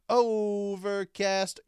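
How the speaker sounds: very slow, high and loud; drawn out. Real, not acted. Host listing podcast apps, this one drawn way out. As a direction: stretch the first vowel like an announcer. Host stretches a name in a list.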